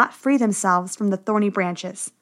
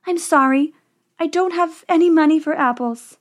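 put-on higher, sorry young princess; apologetic princess; 6 semitones higher than usual. A narrator's reading. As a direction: politely apologise in a raised princess voice.

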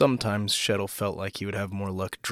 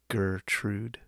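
slowly savoured; 4 dB quieter than usual. A narrator's reading. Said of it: "Shettle said the name slowly".

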